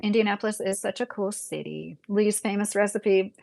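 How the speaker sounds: usual speaking voice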